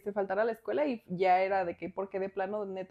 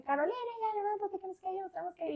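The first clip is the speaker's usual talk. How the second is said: high, exasperated mom yelling to get up; much higher, an irritated mother voice; angry mom; 9 semitones higher, 8 dB quieter, faster than usual. Retold or imitated. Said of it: "ya mucho más enojada". (mamá enojada, voz aguda)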